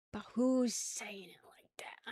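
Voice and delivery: slow breathy whisper; hushed whisper. Real, not acted. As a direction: whisper it aside. Whispered aside about pronunciation.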